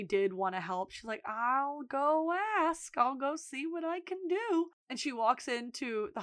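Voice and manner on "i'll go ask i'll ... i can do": high, drawn-out, gentle voice; reluctant kind offer. Retold or imitated. Manager agreeing to help fired worker, gentle offer. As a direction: stretch it out slowly, pitched up, obliging.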